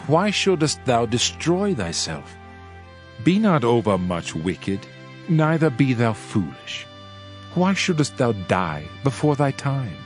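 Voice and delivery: plain reading voice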